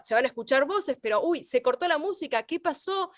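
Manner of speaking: ordinary talk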